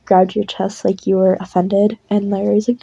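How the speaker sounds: ordinary delivery, nothing marked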